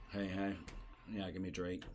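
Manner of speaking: quiet, low, offhand drink order; low-key retold bar order. Customer ordering at the bar, low-key.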